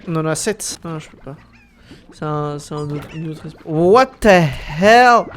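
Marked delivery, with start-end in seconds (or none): 3.6-5.2 drawn out, very slow and loud; stunned exclamation. Real, not acted. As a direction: stretch it out in disbelief. Reacts to strange creatures.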